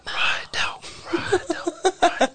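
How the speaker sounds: secret whispered cheer; whispering to himself. Retold or imitated. Supporter cheering someone on in his head, whispering. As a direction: whisper encouragement under your breath. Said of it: "Imagine me just whispering to myself".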